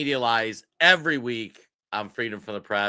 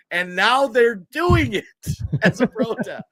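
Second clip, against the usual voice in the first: words breaking into a high, loud laugh; can't hold back the laugh at the irony; laughing in disbelief; 9 semitones higher, 5 dB louder than usual. Real, not acted. Host mocking the protest to viewers, laughing in disbelief.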